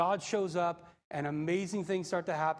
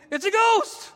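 hammed-up panicked shout, loud and high; terrified cry; 11 dB louder, slower than usual. Disciples seeing Jesus walk on the sea, terrified cry. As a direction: cry out in mock terror. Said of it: "they cried out for fear".

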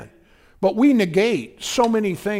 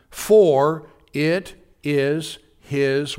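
drawn-out prompting; 4 dB louder, much slower than usual. Shown as a demonstration. Preacher pausing for congregation to fill in.

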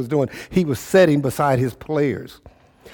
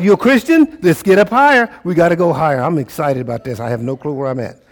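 normal talking voice

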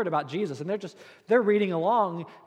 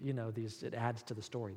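hushed spooky aside; 7 semitones lower, 12 dB quieter than usual. Retold or imitated. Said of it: "you're like".

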